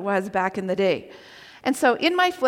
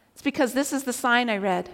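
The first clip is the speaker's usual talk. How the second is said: ordinary delivery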